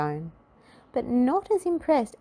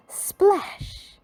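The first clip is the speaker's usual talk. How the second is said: high loud splash effect; high cartoon splash call; splash sound; 14 semitones higher, 5 dB louder than usual. A narrator's reading. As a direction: shout the splash. Sound of falling into pool.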